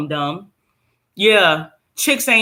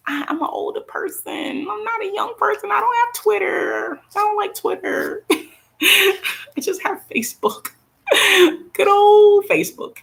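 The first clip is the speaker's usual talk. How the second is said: playful high voice. Pretend. Joking about only having Facebook.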